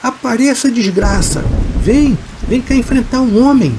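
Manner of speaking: high-pitched, daring challenge; provoking taunt. A narrator's reading. Fidêncio dares the hidden thing to fight, high taunt. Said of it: "começou a provocar".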